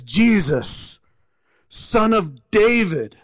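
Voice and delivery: restrained retold cry, stretched out; mock cry for attention, not shouted. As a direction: imitate a beggar calling out, drawn out. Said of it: "the man just cries out".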